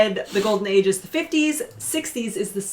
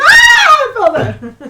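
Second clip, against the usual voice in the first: mock scream falling; 13 dB louder, slower than usual. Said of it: "they both just like".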